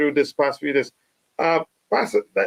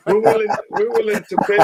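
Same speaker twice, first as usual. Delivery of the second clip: laughing; 6 semitones higher, faster than usual. Real, not acted. Laughs while repeating the offer.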